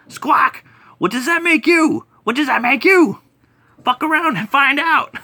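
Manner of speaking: bird alien voice. Retold or imitated. Bird alien taunting the mini boss, high squawky voice. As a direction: screech it out like an angry bird creature.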